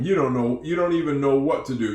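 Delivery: ordinary talk, nothing marked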